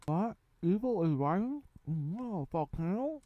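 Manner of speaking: high, puzzled questions, hammed up; mock confused guessing. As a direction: play a bewildered villager guessing the threat. Mock characters guessing the threat.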